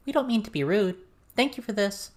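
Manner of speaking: a hint of a shy girl saying thanks; lightly voiced polite girl. A narrator's reading.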